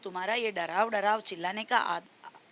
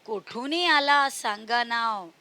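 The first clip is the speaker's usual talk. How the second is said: put-on sing-song, louder recital of a children's poem; poem recitation; 5 dB louder, slower than usual. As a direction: recite the verse loudly for kids. (बच्चों को कविता सुनाते हुए, ज़ोर से गाकर पढ़ना)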